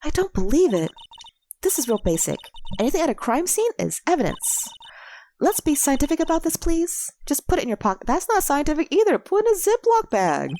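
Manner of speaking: put-on high, scolding young girl; indignant girl voice. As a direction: lecture him like a know-it-all girl.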